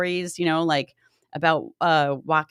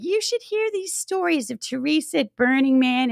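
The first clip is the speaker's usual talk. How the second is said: lightly voiced chirpy grandma; a hint of old lady cheer; 9 semitones higher than usual. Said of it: "she was just saying".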